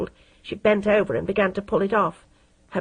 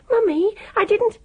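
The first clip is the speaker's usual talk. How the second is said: put-on much higher, small pleading girl voice; high frightened plea; 13 semitones higher than usual. A narrator's reading. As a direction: squeak it out in horror.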